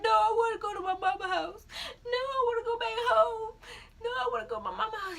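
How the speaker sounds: high mock wailing, back and forth; hammed-up tearful pleading; imitated crying. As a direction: bawl it like an indecisive crybaby. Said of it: "I burst out and started crying".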